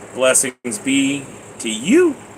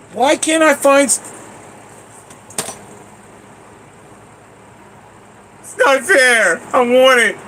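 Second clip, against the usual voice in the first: whiny frustration; 11 semitones higher, slower than usual. Real, not acted. Can't get the snack.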